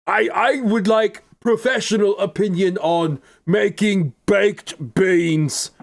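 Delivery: high, put-on clueless voice; exaggerated naive question to an expert; mock dim-witted asker. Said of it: "suddenly speaking normally like a".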